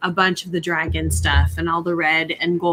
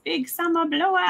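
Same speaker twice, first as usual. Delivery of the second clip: Oaken catchphrase; 10 semitones higher, 3 dB quieter than usual. Retold or imitated.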